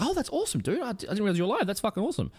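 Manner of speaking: very high, overjoyed voice; delighted Strasky. Retold or imitated. Strasky hearing Ackers is alive, very high and overjoyed. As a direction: squeal it like a naive friend thrilled at the news. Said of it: "Strasky's like".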